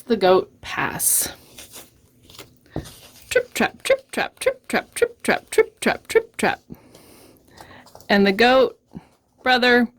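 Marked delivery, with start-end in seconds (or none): 3.3-6.6 crisp, staccato, barely voiced trip-trop; light hoof steps. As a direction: tap out the words like tiny hooves. Middle goat crosses the bridge.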